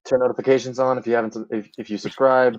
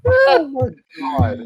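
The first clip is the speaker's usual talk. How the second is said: exasperated high cry; 14 semitones higher, 4 dB louder, much slower than usual. Real, not acted. Reacting to the fanfic.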